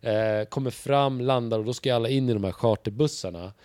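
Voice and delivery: ordinary talk, nothing marked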